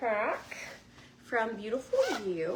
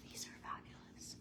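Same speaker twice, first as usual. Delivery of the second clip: much softer, unvoiced; awed whisper; 16 dB quieter than usual. Real, not acted. Admiring the sunglasses, an awed whisper. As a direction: breathe it out in awe.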